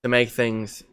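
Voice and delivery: put-on English accent, insisting innocently; casual mock-English explanation. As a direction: bluff along in a fake English accent. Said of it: "you're from the U K".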